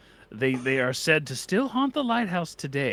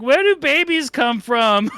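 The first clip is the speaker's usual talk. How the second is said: put-on high, loud, slow innocent kid question; child voice; 10 semitones higher, 8 dB louder, slower than usual. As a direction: ask like a curious little child. Child ghosts asking ancestors.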